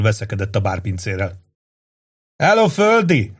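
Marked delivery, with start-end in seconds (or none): 2.4-3.3 bellowed greeting; shout. A narrator's reading. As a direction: call out over the crowd. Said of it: "ordított integetve".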